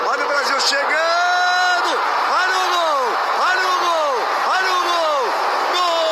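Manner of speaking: high, slow shouted build-up; explosive goal commentary. Acted. Repeated olha o gol.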